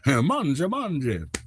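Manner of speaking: a hint of Italian accent, laughing; mock Italian accent. Joking with chat in a light mock Italian accent. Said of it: "Italian pizza guy".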